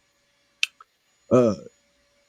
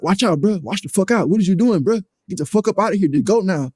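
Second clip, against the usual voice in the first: raised, urgent retold order to back off; urgent warning; 6 semitones higher than usual. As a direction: retell a heated warning, pitched up.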